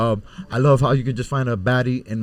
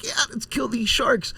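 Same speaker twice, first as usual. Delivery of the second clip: pitched high, slow and pumped; fired up to go kill sharks; 11 semitones higher than usual. Retold or imitated. Hyped by a movie speech.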